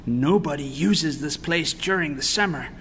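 ordinary delivery

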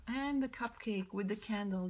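normal talking voice

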